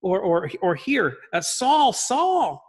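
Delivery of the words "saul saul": high, loud, ringing call of the name; booming voice from heaven. Acted. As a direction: call down from the sky with authority. Voice from heaven again.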